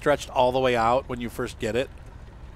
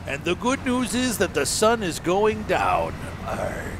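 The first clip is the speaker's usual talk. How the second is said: higher, slow pirate drawl; 6 semitones higher, slower than usual. Pretend. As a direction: growl it like a jolly pirate. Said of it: "Why am I talking like a pirate?".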